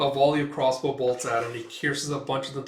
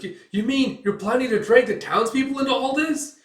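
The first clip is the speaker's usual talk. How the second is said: high, loud stammered outcry; acted indignant elf; appalled disbelief; 11 semitones higher, 7 dB louder than usual. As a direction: exclaim in shock, rising to the end.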